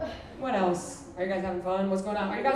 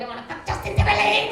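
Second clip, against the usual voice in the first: much higher, excited squeal; childish squeal; 10 semitones higher, 4 dB louder than usual. Retold or imitated. Comic as her seven-year-old self, high excited squeal. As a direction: squeal like a giddy kid. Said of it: "I was seven years old".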